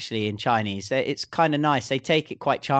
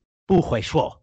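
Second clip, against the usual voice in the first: mock angry; 2 semitones higher than usual. Shown as a demonstration. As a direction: say it as if cranky before breakfast. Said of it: "That's if you're kind of angry".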